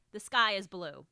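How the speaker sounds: "The sky is blue." said loud and flat, as a firm statement. Shown as a demonstration. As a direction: state it with no upward lilt. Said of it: "this is a statement".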